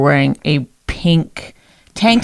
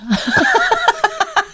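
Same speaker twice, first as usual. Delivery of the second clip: breaking into laughter. Real, not acted. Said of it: "I can't".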